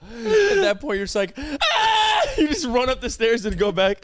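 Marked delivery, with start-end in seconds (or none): 1.4-2.2 high-pitched comic scream; mock panicked scream. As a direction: imitate a panicked yelp, pitched way up. Imitating friend's panicked flight upstairs.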